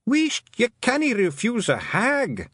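high, Scottish warning from the back; heckling Feegle. Acted. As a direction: pipe up urgently, slow and high. Another Feegle calls from the back.

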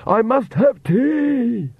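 high, slow, craving shriek; screamed. A narrator's reading. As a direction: shriek it like a desperate vampire, stretching the words. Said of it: "one screamed".

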